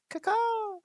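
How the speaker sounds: high crying caw; a harsh bird cry; raven caw. Acted. Raven caws mid-warning, high and sharp.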